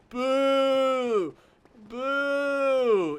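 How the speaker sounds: loud, high, drawn-out mock booing; heckling boo. Old drunk heckles bands between songs, imitated loud booing. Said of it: "so this guy's heckling".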